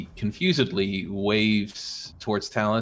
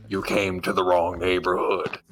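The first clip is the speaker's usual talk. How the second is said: eldritch horror. Acted. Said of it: "Some eldritch voice in the back of your head says".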